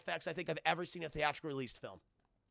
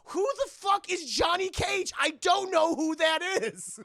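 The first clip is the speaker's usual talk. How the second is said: high, loud exasperated yelling; mock angry shout; 11 semitones higher, 9 dB louder, slower than usual. Jax has no idea who Johnny is, high mock-outraged shout. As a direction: stretch it out in hammed-up outrage. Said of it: "I'm just fucking mad".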